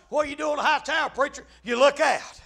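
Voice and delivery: ordinary talk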